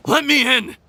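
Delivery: rising angry shout to be let in; angry demand. Acted. Demanding entry at the door.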